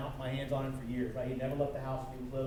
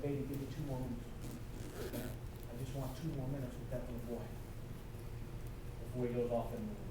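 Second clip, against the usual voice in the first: soft plea; 5 dB quieter than usual. Retold or imitated. Tender plea to son's girlfriend.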